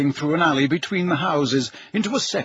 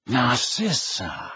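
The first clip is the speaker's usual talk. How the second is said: slow Snape voice; 2 semitones lower, much slower than usual. Acted. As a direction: say the name slowly, coolly. The man at the door.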